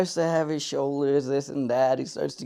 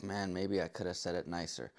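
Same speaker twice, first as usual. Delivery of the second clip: guilty murmur; 9 semitones lower, 14 dB quieter than usual. Retold or imitated. Guilty inner voice.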